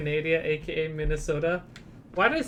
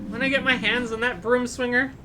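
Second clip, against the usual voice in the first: lightly voiced patients and staff, raised pitch; voiced game characters; 6 semitones higher than usual. Streamer reading game dialogue aloud, lightly character-voiced. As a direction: read each hospital character in a higher put-on voice.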